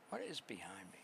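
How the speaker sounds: unvoiced, alarmed whisper. Real, not acted. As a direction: breathe it, spooked.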